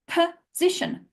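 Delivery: "position" as puh-ZI-shun, first syllable set apart; two schwa sounds. Shown as a demonstration. Teacher points out the first O, "position" starting puh. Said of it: "Position has two schwa sounds".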